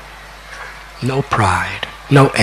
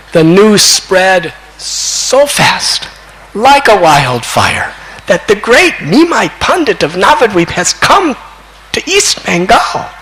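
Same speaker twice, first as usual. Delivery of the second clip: impassioned storytelling; 7 semitones higher than usual. Acted. Preacher telling how news of Nimai's arrival spread, impassioned storytelling. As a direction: proclaim big news, pitch swooping up.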